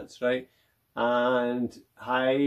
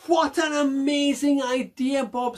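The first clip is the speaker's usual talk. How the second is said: excited; 9 semitones higher, 4 dB louder than usual. Acted. Mac delighted.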